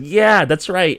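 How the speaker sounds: high, loud, playing along; feed off the other's excitement; eager agreement. Driver humours the cop to avoid a ticket, eager agreement. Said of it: "I try to feed off of that".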